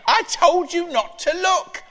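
put-on high, far-off old granny voice. Grandmother answering her grandson, in a high granny voice.

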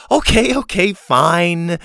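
high, loud showy surrender; theatrical diva relenting; mock reluctant giving in. A narrator's reading. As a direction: give in as if begged. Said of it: "as if being begged to go back up".

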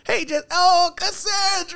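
very high mock greeting; imitated coworker. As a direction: squeal like an intrusive coworker. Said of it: "she'll come in there".

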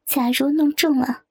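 ordinary delivery

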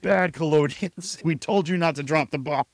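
high, loud telling-off; hammed-up outraged reprimand; mock scolding. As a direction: scold like at a naughty pet. Acts out scolding the bombers.

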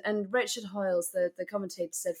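normal talking voice

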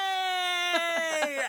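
high, shrill cheer; children cheering. Acted. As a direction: squeal with delight.

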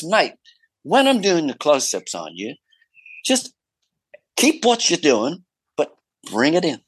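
an Aussie director giving friendly notes, half mimicked; Aussie director impression. Director coaching the actor about close-ups, in a light Aussie accent. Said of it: "he might have been an Aussie".

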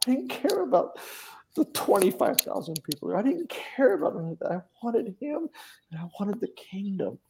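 high, cracking, tearful voice; real crying. Real, not acted. Recalling surrender to the hosts, openly crying. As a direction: let the tears break through every word. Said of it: "I'm bawling now".

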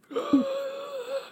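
sudden high undead gasp; a shrill zombie gasp leaping out. Pretend. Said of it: "zombie impressions now".

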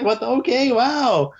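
imitating a baffled friend, squeaked up high; baffled surprise. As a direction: caught off guard by the hug. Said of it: "He's just like".

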